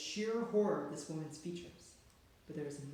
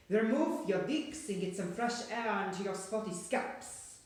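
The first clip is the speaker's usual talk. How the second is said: put-on harsh guttural foreign accent, louder and slow, rolled R; foreign witch accent; 4 semitones higher, 6 dB louder than usual. A narrator's reading. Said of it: "some sort of a foreign accent there, something harsh and guttural".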